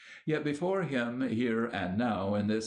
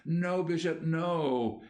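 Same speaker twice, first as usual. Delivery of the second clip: anguished outcry; 6 semitones higher than usual. A narrator's reading. Newbold crying out to the grieving bishop in denial. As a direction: burst out in pained denial. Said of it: "Murray Newbold cried out".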